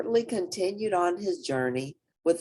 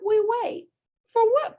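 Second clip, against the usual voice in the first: high character voices; 5 dB louder than usual. Acted. Bat and Portly voiced.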